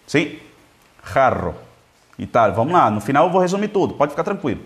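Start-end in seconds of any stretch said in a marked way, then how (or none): 1.1-1.6 "Jarro" with rasped j and trilled rr; Spanish pronunciation. Shown as a demonstration. Teacher modelling it once more for students, Spanish pronunciation. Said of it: "Em espanhol".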